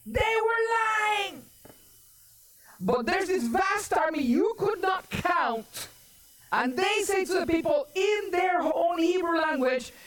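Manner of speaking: high-pitched, driving sermon delivery; forceful preaching. Acted. Preacher retells the enemy's threat to God's people, forceful preaching. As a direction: preach it high with drawn-out key words.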